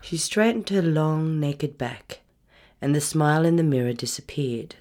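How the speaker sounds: plain reading voice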